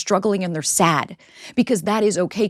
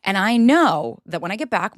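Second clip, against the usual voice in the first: usual speaking voice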